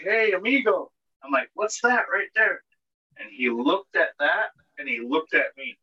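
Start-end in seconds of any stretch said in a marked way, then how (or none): 0.0-2.6 higher, easygoing call to the stranger; a light mock-friendly hey to a guy; casual greeting to stranger.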